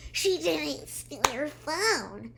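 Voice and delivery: high, slow, drawn-out objection; mock indignant protest. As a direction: imitate someone protesting in a put-on voice. Character defends Sammy in quarrel.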